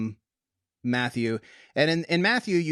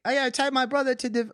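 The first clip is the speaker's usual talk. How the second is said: high, quick mock-British heckler; hammed-up British interruption; terrible British accent; 12 semitones higher, faster than usual. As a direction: pipe up in a badly faked British accent. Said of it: "that's a terrible British accent".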